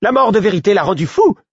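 high, loud accusing cry; yelling. A narrator's reading. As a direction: shout it across the hall. Onlooker yelling in the melee.

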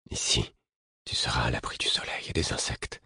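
soft soothing whisper. A narrator's reading. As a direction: whisper to calm him. Soothing a delirious fool.

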